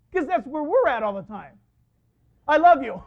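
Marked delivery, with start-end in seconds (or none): 2.5-3.0 a much louder, lifted declaration; God's loving call. Retold or imitated. God telling Sarah he loves her, loud and warm. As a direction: call it out as a promise. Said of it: "God says".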